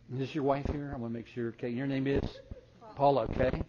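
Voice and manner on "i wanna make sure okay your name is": usual speaking voice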